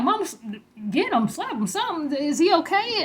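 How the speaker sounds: high, loud panicked cry to mom; panicked shout. Retold or imitated. Panicking over her frozen brother, a high loud shout. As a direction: yell in alarm at the blank-eyed boy.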